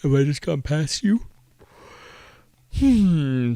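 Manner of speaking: talking through a yawn; sleepy, mid-yawn words. Real, not acted. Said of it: "excuse me and my yawns".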